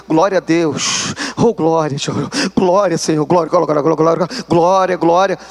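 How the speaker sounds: stubborn murmured praise. Retold or imitated. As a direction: mumble praise to dodge repeating. Praying, refusing to repeat.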